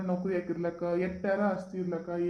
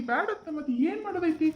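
high-pitched, pleading argument; acted father's worked-up protest; exasperated reasoning; 8 semitones higher than usual. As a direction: press the point with raised pitch. Father argues against forcing the marriage.

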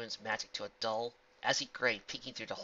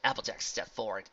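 plain reading voice

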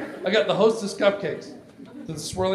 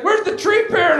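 calling out; 5 dB louder than usual. Acted. Acts Meredith calling out.

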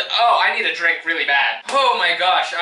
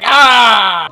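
mock scream; 5 semitones higher, 11 dB louder than usual. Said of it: "they were like".

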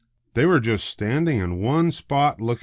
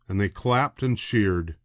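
ordinary delivery, nothing marked

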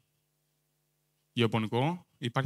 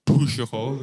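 pushy hole in a put-on English accent, louder; 3 semitones lower, 5 dB louder than usual. Shown as a demonstration. Comic explains his word, loud English-accent pushy hole. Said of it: "Πρέπει να το πείσουμε προφορά αυτό".

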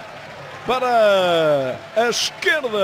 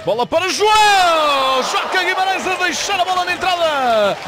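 goal call commentary; 4 semitones higher than usual. Acted. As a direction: shout the goal over the crowd. (relato de golo entusiasmado)

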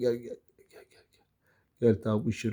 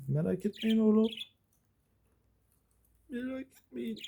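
cooing reassurance; 8 semitones higher than usual. Real, not acted. Owner reassuring the pets, high cooing. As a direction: soothe them in a high little voice.

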